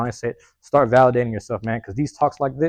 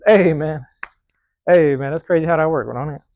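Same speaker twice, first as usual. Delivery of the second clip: sarcastic jab; 5 semitones higher, 6 dB louder than usual. Real, not acted.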